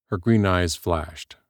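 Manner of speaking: ordinary delivery, nothing marked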